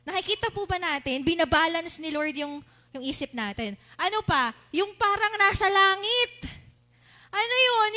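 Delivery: ordinary talk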